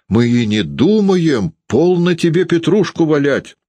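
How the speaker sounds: high, anxious denial; trembling voice. A narrator's reading. Varvara denying the move to the landlady, anxious and high. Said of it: "дрожащим голосом говорила Варвара".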